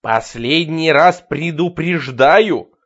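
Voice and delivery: slow, loud menacing warning; threatening. Acted. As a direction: warn him with real menace. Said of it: "с угрозой в голосе".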